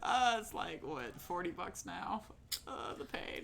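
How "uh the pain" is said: unvoiced, pained whisper; whispered groan. Real, not acted. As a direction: breathe it out in mock pain. Card costs forty bucks.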